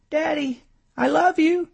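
put-on very high child voice; little girl's voice. A narrator's reading. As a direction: voice a small daughter speaking to her daddy. Little daughter's voice in story.

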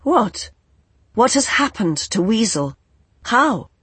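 sudden high alarm. A narrator's reading. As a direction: ask in rising panic. Fever alarmed questions.

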